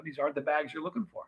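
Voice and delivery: a hint of a Jedi mind trick, fast and hushed. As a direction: lightly wave it past him like a mind trick. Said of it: "like the Jedi mind trick".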